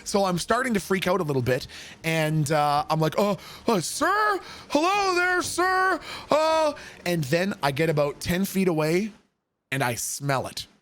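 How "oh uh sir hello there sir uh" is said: high, timid falsetto politeness; much higher nervous sir voice; nervous polite. Retold or imitated. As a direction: squeak out a scared polite greeting. Nervous polite greeting to stranger.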